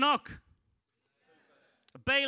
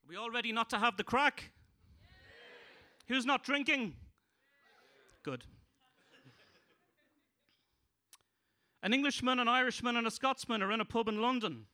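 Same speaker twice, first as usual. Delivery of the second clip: loud, higher stand-up punchline voice; joke-telling mode; 8 semitones higher, 9 dB louder than usual. Acted. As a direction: announce the joke to the room. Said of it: "would you like to hear some anti-crack jokes".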